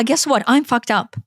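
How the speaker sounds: ordinary talk, nothing marked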